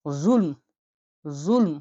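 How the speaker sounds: জুলম with a heavy, deep z sound. Shown as a demonstration. Teacher demonstrating the deep Arabic z, heavy and dark. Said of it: "গভীর".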